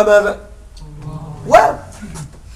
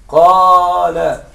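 قال stretched very slowly, qaaal; drawn-out teaching word قال; slow demo; 4 semitones lower, much slower than usual. Shown as a demonstration. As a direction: hold the long aa of قال. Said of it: "الفعل قال".

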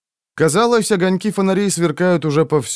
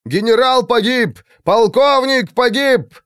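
much higher triumphant shout; 10 semitones higher than usual. A narrator's reading. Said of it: "крикнул главарь белых рыцарей".

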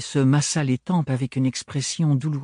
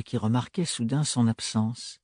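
usual speaking voice

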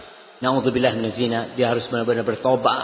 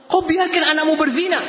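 high, forceful rebuke; stern rebuke; 13 semitones higher than usual. Real, not acted. Preacher rebuking negligent parents, high and stern. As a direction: thunder the warning.

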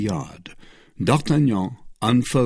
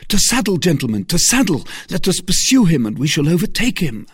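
high, loud call to action; rally them for the chase; urgent rallying cry; 10 semitones higher, 6 dB louder, faster than usual. Acted. D'Artagnan to his friends, urgent loud cry.

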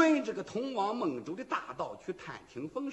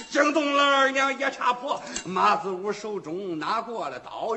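ordinary delivery, nothing marked

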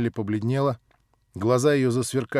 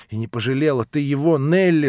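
loud, higher outcry; reproachful cry; 4 semitones higher, 7 dB louder than usual. Acted. Narrator reproaches Nelly, loud cry. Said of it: "вскричал я".

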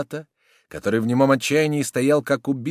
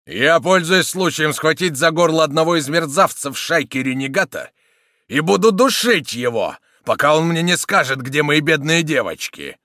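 loud, raised, furious declaration; angry threat; 6 semitones higher, 8 dB louder than usual. A narrator's reading. Soldier with the villain by the throat, angry threat. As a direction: threaten him at full voice. Soldier angrily choking his enemy.

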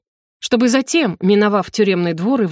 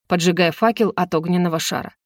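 ordinary delivery, nothing marked

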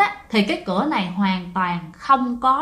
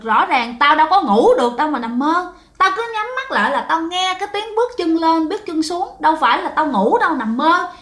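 higher-pitched, insistent retelling of a protest; 6 semitones higher than usual. Acted. Roommate denies dreaming to her friend, insistent protest.

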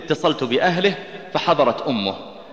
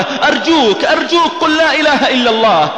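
tearful pleading; 10 semitones higher, 4 dB louder than usual. Real, not acted. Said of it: "أخذت والله أبكي بحرقة".

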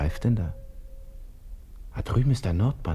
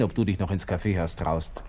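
ordinary delivery

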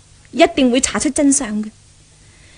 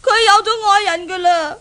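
higher, crying complaint; tearful complaint; 7 semitones higher than usual. Acted. Daughter complaining to father about rival, tearful. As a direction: sob out the bad news. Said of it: "一個人喺間房度喊".

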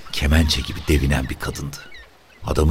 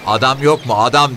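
calling out; 10 semitones higher, 5 dB louder than usual. A narrator's reading. Officer calling out impatiently.

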